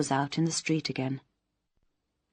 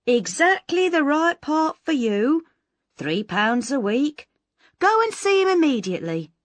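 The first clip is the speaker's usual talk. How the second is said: high, louder effusive agent voice; gushing agent; 8 semitones higher, 5 dB louder than usual. Acted. Imagined Mister Cadgett greets Miss Moss, a high gushing voice. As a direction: pour on the imagined welcome.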